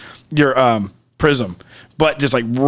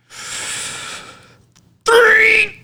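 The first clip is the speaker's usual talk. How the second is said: long, much higher strained cry; strained yell. Retold or imitated. Taking the last hit, yelling it out long. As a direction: hold a long yelled three.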